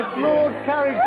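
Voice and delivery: ordinary talk, nothing marked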